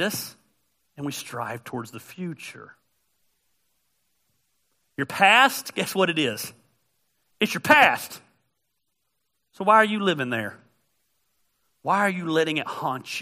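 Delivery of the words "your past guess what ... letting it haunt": loud, higher urgent preaching; full-force sermon delivery; fiery preaching. Acted. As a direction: press the point home hard.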